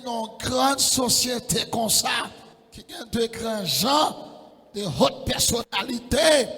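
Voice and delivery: loud, driving preacher's voice; full-force preaching. Real, not acted. Preacher pressing the congregation with a loud call.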